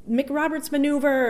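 midwife shouts. Retold or imitated. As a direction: call the manoeuvre out like an alarm. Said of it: "the midwife shouts".

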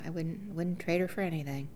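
usual speaking voice

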